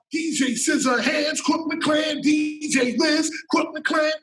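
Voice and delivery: high shouted hype call; record hype shout. Retold or imitated. Copying the hot record on the radio, shouted hype. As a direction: shout the names like a mixtape drop.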